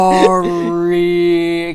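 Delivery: loud, long-held mock sorry; mock apology. Onlookers apologizing to the tired runner, mock loud drawn-out. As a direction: stretch the word out loudly, hamming it up. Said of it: "And they're like".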